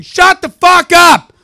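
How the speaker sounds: high loud furious shout at the improv troupe; angry yell. Pretend. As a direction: pretend telling off, shouted high. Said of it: "going to that show and saying".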